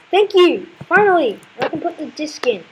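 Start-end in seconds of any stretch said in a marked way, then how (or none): 0.1-1.4 high, loud burst of relief; exasperated, triumphant relief; relieved exclamation. Real, not acted. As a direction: cry out as the fight finally ends. Enemy dies at last.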